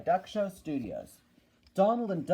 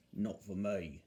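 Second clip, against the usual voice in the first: low, quiet bus grumble; Bulgy; 9 semitones lower, 7 dB quieter than usual. Acted. Bulgy disagreeing about the small railway, low and quiet. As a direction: drop down to disagree. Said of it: "said Bulgy".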